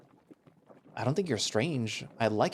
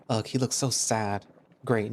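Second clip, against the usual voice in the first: usual speaking voice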